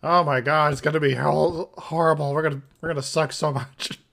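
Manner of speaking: loud excited dread. Real, not acted. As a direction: loudly groan about the horror ahead. Dreading upcoming game, laughing.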